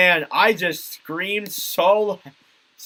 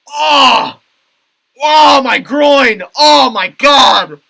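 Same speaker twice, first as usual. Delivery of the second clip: loud, high, drawn-out cries of agony; pained outcry; 9 semitones higher, 12 dB louder, much slower than usual. Acted. Watcher reacting to Tkachuk's groin hit, pained outcry. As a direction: wail it slowly as if you took the hit.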